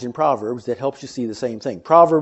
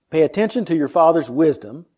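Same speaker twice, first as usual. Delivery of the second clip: ordinary talk, nothing marked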